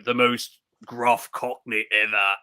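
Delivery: a hint of gruff Cockney accent. Describing Orlando Bloom's delivery, lightly imitated gruff Cockney. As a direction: lightly put on a rough Cockney. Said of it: "his delivery in this, he's like".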